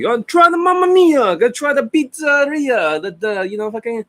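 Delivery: hammed-up Italian shouting about pizza; mock Italian accent. Mocking how Italians talk to the client, in a hammed-up Italian accent. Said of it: "they're expressive in their hands".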